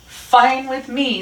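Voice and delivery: high, loud, drawn-out retort; defiant retort. A narrator's reading. Rat answers the snarling Dog, high and defiant. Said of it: "said Rat".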